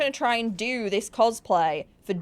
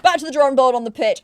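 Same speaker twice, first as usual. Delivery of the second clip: mock declaration; 4 semitones higher, 7 dB louder, faster than usual. Repeat, louder and higher.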